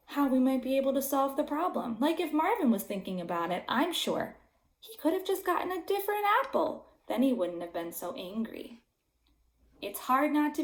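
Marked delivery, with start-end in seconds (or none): none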